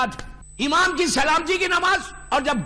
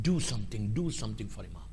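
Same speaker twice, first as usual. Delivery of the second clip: hushed urging; 12 dB quieter, slower than usual. Real, not acted. Preacher urging listeners to act.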